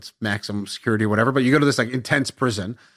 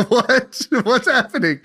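laughing confusion; 10 semitones higher, slower than usual. Retold or imitated. Confused, laughing at being called out.

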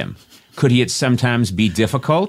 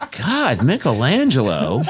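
ordinary talk, nothing marked

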